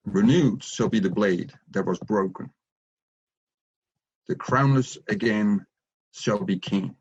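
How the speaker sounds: slow, solemn recitation with long pauses; slow reverent poem reading; poem recital. Shown as a demonstration. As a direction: recite it like sacred verse. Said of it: "I will just say it".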